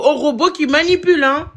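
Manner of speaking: usual speaking voice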